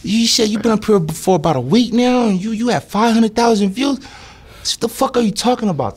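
way up high, teasing; high mock detective voice. Storyteller mimicking the detective's teasing greeting, high and mocking. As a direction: pitch it high like a mocking cop.

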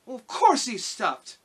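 put-on high, loud indignant girl; angry. A narrator's reading. Dorothy answers the lion while still angry, high cross voice. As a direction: answer crossly, pitched well up. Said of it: "who was still angry".

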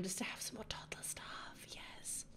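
breathy whispered delight; whispering. Real, not acted. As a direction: whisper it, almost to yourself. Gushing about toddler items.

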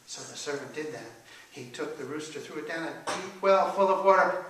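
plain reading voice

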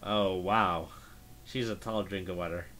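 ordinary talk, nothing marked

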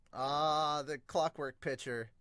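normal talking voice

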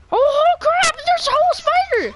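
high panicked yelp, very loud; startled shriek. Real, not acted. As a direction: jump out of your skin. Sudden enemy in game.

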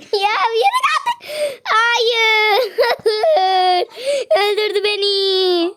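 slow, laughing groan; laughing despair. Real, not acted. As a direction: laugh helplessly while dying. Being killed in game. (gülerek isyan)

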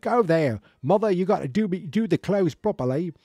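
high, loud mock Cockney man; loud Cockney, pitched well up. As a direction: call out in a hammed-up Cockney accent. Answering in the same Cockney scene.

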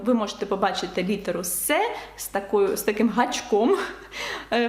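ordinary talk

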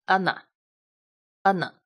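"啊呢" with a closed a, mouth kept nearly shut; closed a demo. Shown as a demonstration. Teacher showing students how "啊呢" must sound. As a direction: say "啊呢" with the a closed, not open. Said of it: "你一定要发成".

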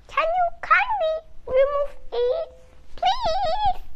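high, loud, fast eager donkey; high donkey voice begging politely. Acted. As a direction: gush it out, then plead. Donkey tricks the wolf.

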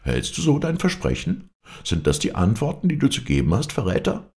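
scandalised, high-pitched reproach; indignant outcry. A narrator's reading. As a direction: confront a traitor, pitched high. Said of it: "riefen die Schildbürger aus".

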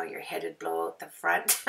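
usual speaking voice

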